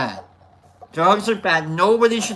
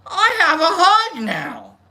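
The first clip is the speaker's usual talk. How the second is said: slow awed delight; 8 semitones higher, 4 dB louder, slower than usual. Retold or imitated. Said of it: "and I'm like".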